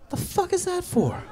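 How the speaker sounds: high, incredulous squeak; shocked. Retold or imitated. Shocked at the clerk's suction cup, high squeak. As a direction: disbelief jumps way up.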